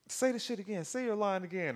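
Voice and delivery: high, pushy mock director voice; a high-pitched lady ordering a retake; bossy director lady. Lady on set ordering Vision to redo, high mock voice. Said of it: "and the lady was like".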